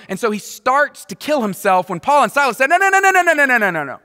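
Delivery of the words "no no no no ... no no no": rapid high-pitched string of no's; frantic acted intervention; urgent protest. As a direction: blurt it out loud to stop someone, falling away. Jailer stopped from killing himself.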